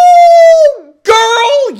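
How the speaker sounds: high loud disbelieving cry; shocked. Real, not acted. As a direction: shriek in disbelief. Disbelief at fine.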